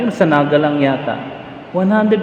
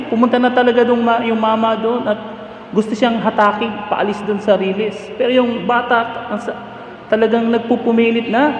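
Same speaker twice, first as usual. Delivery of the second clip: ordinary delivery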